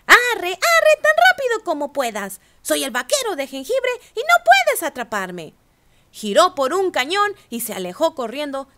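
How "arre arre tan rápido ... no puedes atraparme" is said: high, loud taunting sing-song; teasing cookie voice. A narrator's reading. Gingerbread cowboy taunting the ranchers, high loud sing-song. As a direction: taunt them, high and cocky. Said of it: "se rió y dijo".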